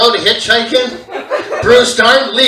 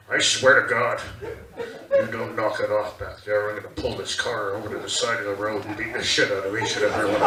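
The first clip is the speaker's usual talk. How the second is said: put-on low, menacing dad threatening the kids; deep, fast threat from the driver's seat; angry dad threat; 12 semitones lower, 11 dB quieter, faster than usual. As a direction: growl it like a fed-up father at the wheel. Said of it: "my dad would go".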